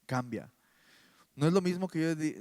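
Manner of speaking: usual speaking voice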